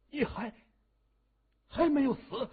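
high, halting shock; astonished, as if seeing the dead alive; stunned disbelief. A narrator's reading. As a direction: stammer it out, voice jumping up. Villain shocked that victim lives.

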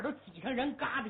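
ordinary delivery

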